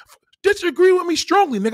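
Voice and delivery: high-pitched, outraged echo; indignant echo. Real, not acted. Streamer answers the caller who disagreed, indignant. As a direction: throw the words back, high and indignant.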